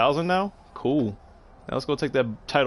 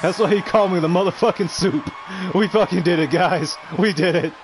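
elated, high-pitched victory cheer; triumphant; 4 semitones higher than usual. Real, not acted. Streamer telling viewers we did it, triumphant. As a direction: celebrate with raised voice and relief.

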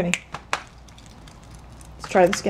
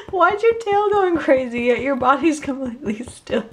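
laughing pet talk; 5 semitones higher than usual. Real, not acted.

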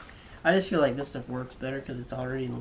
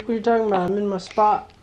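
dog's voice; 5 semitones higher, 7 dB louder, faster than usual. Retold or imitated. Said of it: "He's like".

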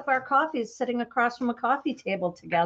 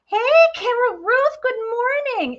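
bright greeting; 11 semitones higher, 6 dB louder than usual. Real, not acted. Greeting a viewer.

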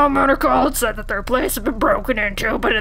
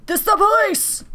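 police shout; 10 semitones higher, 3 dB louder than usual. Pretend. Calling out as police officer.

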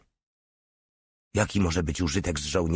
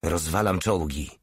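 usual speaking voice

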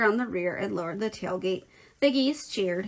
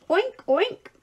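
high-pitched oinking; playful child's pig noises; pig imitation; 11 semitones higher, 3 dB louder than usual. A narrator's reading. Boy tossing straw, oinking like a pig.

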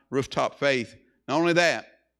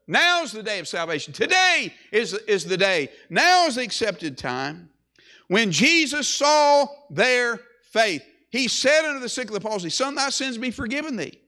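urgent preaching; 5 semitones higher than usual. Acted. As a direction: press the congregation to act now. Urges listeners to come to Christ.

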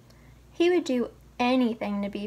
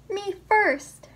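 high, loud "Me first!"; pushy piglet yelling to be first; piggy shout; cried out; 7 semitones higher, 4 dB louder than usual. A narrator's reading. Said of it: "rang out".

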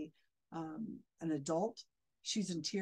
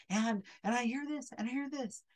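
higher, louder, quick mocking imitation; mock the medium pressing a crying guest, higher and quick; mocking psychic; 7 semitones higher, 4 dB louder than usual. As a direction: rattle off his probing like a pushy medium. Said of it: "And he's like".

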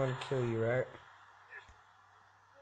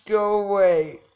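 ordinary delivery

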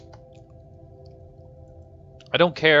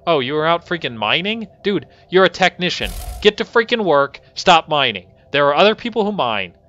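loud, raised-pitch telling-off; exasperated scolding; 5 semitones higher, 5 dB louder than usual. Real, not acted. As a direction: order a slacker back to work. Scolds worker found mining.

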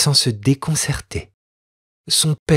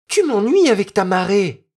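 put-on a mild cross snap from a higher girl's voice; the spoiled girl fed up, mildly angry; annoyed outburst; 10 semitones higher than usual. A narrator's reading. Noémie scolding the boy about the tide, mild annoyance. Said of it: "s'écria Noémie en colère".

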